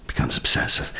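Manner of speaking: hushed breathy aside; whisper. Real, not acted. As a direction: drop to a whisper.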